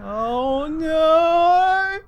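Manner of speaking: very high, loud, drawn-out scream; panicked wail. Acted. Character about to fall again, long loud wail. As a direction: wail in hammed-up terror. Character cries as falling resumes.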